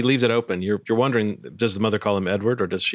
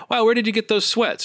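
high, bright nosy friend; Stacy's voice; 11 semitones higher than usual. Acted. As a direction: pitch it up, gushing. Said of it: "Stacy asked the robot".

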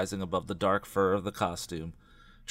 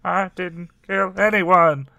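high, slow, louder trembling denial; 9 semitones higher, 7 dB louder than usual. Acted. Tracy denies killing to Dina, trembling voice. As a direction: protest tremulously. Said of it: "in a trembling voice".

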